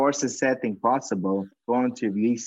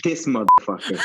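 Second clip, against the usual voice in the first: louder, laughing exasperated curse; laughing disbelief; 3 semitones higher, 6 dB louder than usual. Retold or imitated. Retelling his reaction to the customer's answer to the host, laughing and loud. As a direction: curse him out while laughing.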